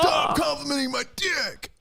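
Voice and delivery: high, shouted mock outrage; hammed-up furious shout. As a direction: shriek it like an offended rock star.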